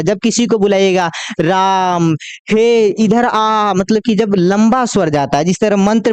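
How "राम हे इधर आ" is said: slow, elongated calling out; drawn-out call. Shown as a demonstration. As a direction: stretch the आ long like calling Ram from far off. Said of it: "इनका उपयोग दूर से बुलाने में किया जाता है".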